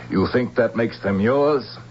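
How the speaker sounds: ordinary talk, nothing marked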